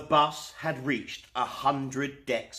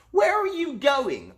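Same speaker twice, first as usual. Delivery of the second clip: sailors asking; 12 semitones higher, 5 dB louder than usual. A narrator's reading. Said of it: "asked the group of sailors".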